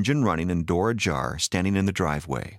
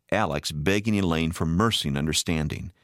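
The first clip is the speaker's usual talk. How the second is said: ordinary delivery, nothing marked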